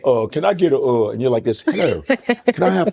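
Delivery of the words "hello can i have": high, slow mock British accent. Big guy ordering as a Brit, in a mock British accent. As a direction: put on a polite British accent. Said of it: "British accent in, um, New York".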